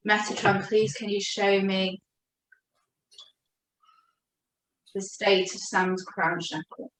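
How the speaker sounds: slow, soft, reverent calling to a spirit; invocation. Acted. Healer quietly asks Metatron to show the client's crown chakra, slow reverent plea. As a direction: ask the angel quietly and slowly.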